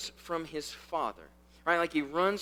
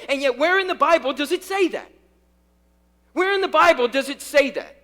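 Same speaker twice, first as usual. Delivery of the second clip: loud, high-pitched pulpit challenge; preaching at full force; 9 semitones higher, 11 dB louder than usual. Real, not acted. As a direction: press the congregation hard, voice raised.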